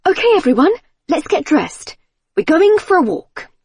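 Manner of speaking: high, bright call to the family; energetic mum rounding up the kids; rush of energy. A narrator's reading. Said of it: "With a rush of energy".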